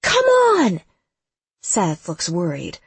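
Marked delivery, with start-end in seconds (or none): 0.0-0.8 high, drawn, desperate yell; screaming. A narrator's reading. Lex begs Damien to answer, screaming. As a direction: scream it, pleading and frantic. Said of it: "I scream".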